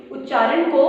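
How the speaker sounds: normal talking voice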